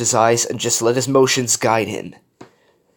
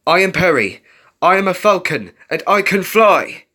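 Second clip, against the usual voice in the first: raised, firm declaration; spirited self-affirming chant; repeated affirmation; 8 semitones higher, 5 dB louder than usual. A narrator's reading. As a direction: declare it higher and louder, short of screaming.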